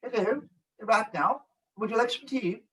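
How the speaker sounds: high, soft put-on British accent; exaggerated, tight-lipped British accent; stiff-upper-lip British. Shown as a demonstration. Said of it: "stiffen your upper lip when you speak British".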